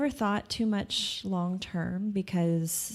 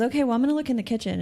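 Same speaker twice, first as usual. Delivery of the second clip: quick retold reply; 6 semitones higher, much faster than usual. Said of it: "And she goes".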